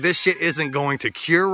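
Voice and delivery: ordinary talk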